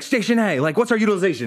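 lightly acted captain calling out; ship's captain. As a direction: call across the deck to a station. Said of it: "felt like we were on a ship".

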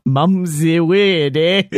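slow, mock Kiwi drawl of mum's the word, eh; mocking New Zealand accent. Cohost parodying his partner's New Zealand accent. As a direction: tease the partner's accent by drawing it out. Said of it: "that was your Australian?".

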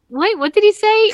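a sudden, startled wait-what; surprised double take. Retold or imitated. Retelling her own double take.

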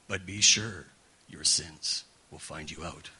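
low, slow, solemn doom voice; ominous plaque voice. Retold or imitated. Preacher recalling grandma's plaque in a low ominous voice. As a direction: intone it like a looming warning. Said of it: "I was always in that tone".

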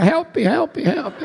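little high-pitched squeal for help; mock tiny yell. Preacher voicing his toe in the wet shoe, mock tiny yell. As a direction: voice a drowning toe, comically high. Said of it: "o dedinho gritava".

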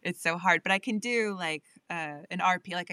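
ordinary talk, nothing marked

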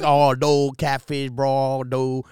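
thick imitated Cajun drawl; Cajun accent impression. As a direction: mimic an Instagram Cajun guy. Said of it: "But the way he says".

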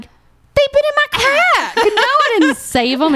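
very high, incredulous squeal; mock indignant outburst. Wife answering husband about saving the pearls, mock indignant. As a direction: retort as if the question is absurd.

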